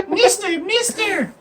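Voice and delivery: put-on high pestering kid call; lightly mocked child calling for attention. Player mimics a kid calling an adult, pestering.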